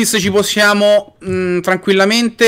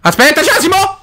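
mock cheerful greeting; 10 semitones higher than usual. Teasing friend about creeper.

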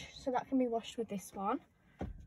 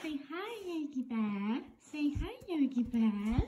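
talking to a dog; 5 semitones higher, slower than usual. Real, not acted. Coaxing the dogs to greet.

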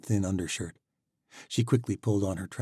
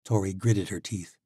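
ordinary delivery

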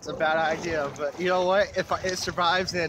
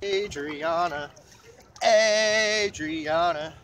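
slow call rising higher each time; drawn-out sing-song calling; 5 semitones higher, much slower than usual. Pretend. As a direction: stretch the name, climbing in pitch.

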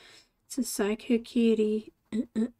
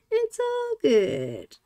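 higher, slow drawn-out reassurance; sing-song reassurance; 9 semitones higher, much slower than usual. Real, not acted. Reassuring viewers while drawing, a slow high sing-song. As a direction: sing it out to calm them.

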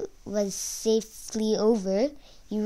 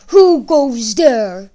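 very loud, slow troll bellow; booming troll roar; 2 semitones higher, 12 dB louder, slower than usual. A narrator's reading. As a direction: bellow the challenge long and loud. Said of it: "a great blowing voice boomed".

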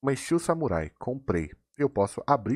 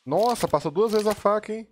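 much higher, amazed exclaim; surprised; 10 semitones higher, 4 dB louder than usual. Real, not acted. Streamer sees the knife roll past, amazed. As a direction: gasp it out in amazement.

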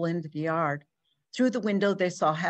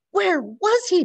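high, loud desperate plea; desperate mother begging to know, high and loud; 12 semitones higher, 7 dB louder, slower than usual. Acted. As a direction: beg for the answer. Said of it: "begged Missus O'Brien".

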